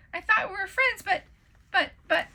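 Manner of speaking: high, loud stammering hurt; stuttered. Acted. Waffle stuttering at friends who run from him. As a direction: stumble over the words. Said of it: "Waffle stuttered".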